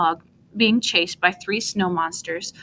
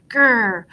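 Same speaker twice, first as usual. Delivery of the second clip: monster growl; 2 semitones higher, 4 dB louder than usual. A narrator's reading. Big snow monster jumps out.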